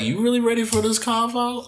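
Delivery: retold comeback. Answering the retold objectors, a high-pitched mock challenge.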